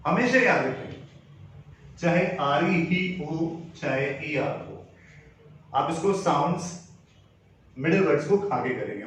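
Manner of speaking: ordinary talk, nothing marked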